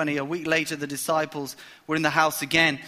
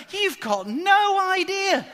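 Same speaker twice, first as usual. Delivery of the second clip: high, slow sarcastic aside; mock disbelief; 12 semitones higher, slower than usual. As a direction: drag it out, mocking their cluelessness. Preacher mocks disciples' ignorance.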